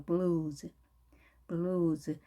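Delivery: "블루즈" said slowly as English blues; low, drawn-out model of "블루즈"; English pronunciation demo. Shown as a demonstration. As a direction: stretch the oo of "블루즈" and end on a buzzing z. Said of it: "블루즈라고 합니다".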